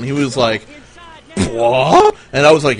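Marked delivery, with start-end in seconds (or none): 1.5-2.2 sharp high startled "What?"; shocked what. Retold or imitated. Friend hears the midget plan, high startled disbelief.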